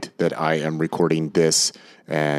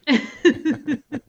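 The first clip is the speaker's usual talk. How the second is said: laugh. Real, not acted. Host laughs at his own joke.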